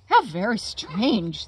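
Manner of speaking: ordinary delivery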